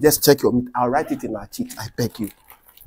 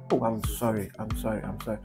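low, quiet repeated sorry; subdued and sorry, trailing down; soft apology; 6 semitones lower, 12 dB quieter than usual. Acted. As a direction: apologise softly, sinking lower. Apologising to the sweating man.

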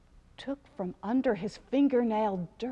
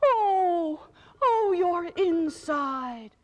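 higher, louder, slow crying echo; 8 semitones higher, 6 dB louder, much slower than usual. A narrator's reading. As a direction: cry her pain back to her. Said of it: "cry too".

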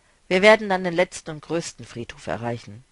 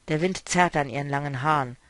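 usual speaking voice

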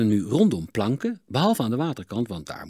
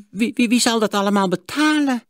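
stammering, much higher in shock; dismayed; 14 semitones higher, 8 dB louder than usual. A narrator's reading. Miser hears the price, dismayed high stammer. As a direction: sputter in alarm. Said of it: "sprak Sigertsma onthutst".

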